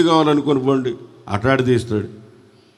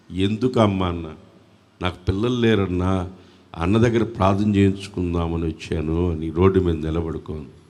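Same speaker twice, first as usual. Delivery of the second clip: low, soft retelling of an encounter; hushed story narration; 8 semitones lower, 4 dB quieter than usual. Real, not acted. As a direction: drop the voice to tell the story intimately.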